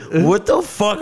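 high, loud incredulous outburst; outraged. Retold or imitated. Recounting his blow-up at the cop, high and loud. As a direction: blow up in disbelief.

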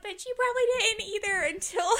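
high, laughing through the words. Retold or imitated. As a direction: giggle the jab out. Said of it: "I'm like".

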